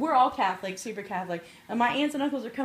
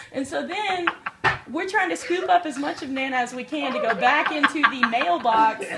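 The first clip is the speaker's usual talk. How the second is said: ordinary talk, nothing marked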